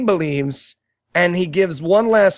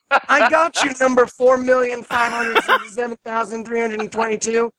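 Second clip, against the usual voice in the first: lightly voiced kids at play, pitched up; a higher, mock playground back-and-forth; children playing; 5 semitones higher than usual. Nameless children playing manhunt in heaven, lightly mimicked children. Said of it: "nameless children in heaven just running around playing".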